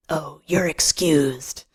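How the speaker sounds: slow, drawn-out sarcastic dismissal; understated, scornful retort; sneer. A narrator's reading. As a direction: grant permission with contempt. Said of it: "I sneer".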